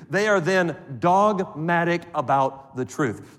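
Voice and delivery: usual speaking voice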